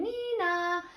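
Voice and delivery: high two-note nee-naw; police siren imitation. A narrator's reading. Cops racing to the farm, imitated siren. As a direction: sing out a siren.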